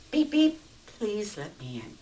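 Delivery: polite beep beep. Acted. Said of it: "the context of how he does it".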